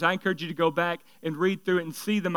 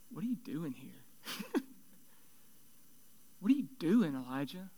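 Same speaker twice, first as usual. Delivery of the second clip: still small voice; 3 semitones lower, 14 dB quieter than usual. Acted. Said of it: "what the still small voice said".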